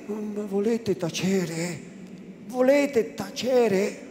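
slow anxious shush; desperate hushing. A narrator's reading. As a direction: beg her to stop talking. Priest hushes her repeatedly.

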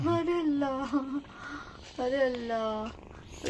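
अरे अल्लाह drawn out slowly and softly; slow drawn exclaim. Real, not acted. Wife drawling अरे अल्लाह slowly at the kitten's antics. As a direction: stretch out अरे अल्लाह in mock awe.

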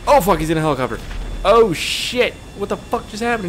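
startled alarm. Real, not acted. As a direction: blurt it out as danger appears. Enemy appears in helicopter.

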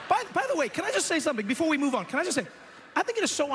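quick, high, eager aside; punchy late night jokes. Acted. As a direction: blurt it out, excited. Sudden aside, fast and high.

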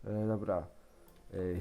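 ordinary delivery

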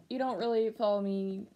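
usual speaking voice

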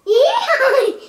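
sudden high laugh; high burst of laughter. Real, not acted. Laughing at the horse impression.

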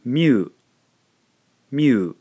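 "mew" said as Japanese myu, loud and clear. Shown as a demonstration. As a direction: model "mew" as a single syllable myu. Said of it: "we end up with".

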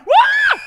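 high loud yelp of fake shock; mock startled yelp. Magician reacts to the vanished nose, loud yelp. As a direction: burst out in stage amazement. Trick reveal.

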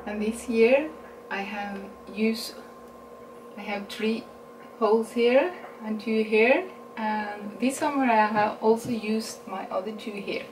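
ordinary talk, nothing marked

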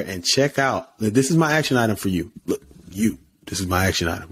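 usual speaking voice

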